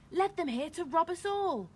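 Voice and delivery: high, loud outrage; shouted accusation. A narrator's reading. Woman with baby to villagers, outraged shouting. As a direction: accuse them for all to hear.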